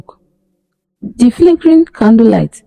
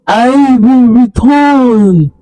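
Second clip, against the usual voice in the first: higher, very slow drawn-out threat; slower echo; 4 semitones higher, much slower than usual. Acted. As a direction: let the final threat hang and stretch. Spirit fades, repeated threat.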